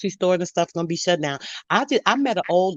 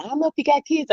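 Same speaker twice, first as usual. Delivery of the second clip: lightly voiced old lady, higher pitched; sweet old lady; 10 semitones higher, faster than usual. Old lady advising a shopper what to stock, lightly imitated. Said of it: "She was a sweet little old lady too".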